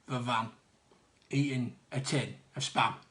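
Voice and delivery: ordinary talk, nothing marked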